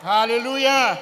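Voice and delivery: ringing sermon exclamation; preacher's hallelujah. Acted. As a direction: lift the church with it. Preacher's shout of praise.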